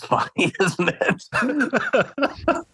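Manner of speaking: high teasing question with laughter; laughing tease. Real, not acted. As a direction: tease him through a laugh.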